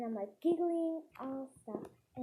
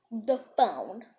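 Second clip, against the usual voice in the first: stern teacher command; 8 dB louder than usual. Acted. Teacher orders girl to the phone.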